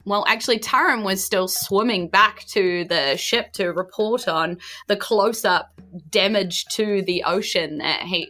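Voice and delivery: normal talking voice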